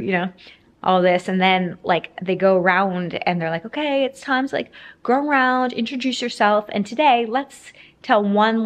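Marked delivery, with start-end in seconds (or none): 3.7-8.6 imitating a class leader, higher-pitched. Class leader tells new parents to introduce themselves, higher-pitched imitation. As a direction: pitch it up like someone kicking off group introductions.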